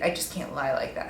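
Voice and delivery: ordinary talk, nothing marked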